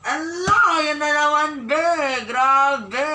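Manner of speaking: high, loud, drawn-out oh my God; thrilled shriek. Real, not acted. Fan watching her favourite couple, shrieking high and loud. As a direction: squeal with delight. Screaming at kissing scene.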